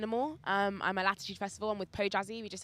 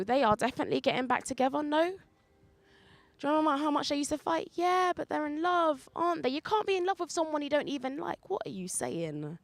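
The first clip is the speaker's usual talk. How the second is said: higher-pitched back-and-forth between bickering friends; lively acted gossip, pitched up; 8 semitones higher than usual. Voices gossiping friends debating the couple.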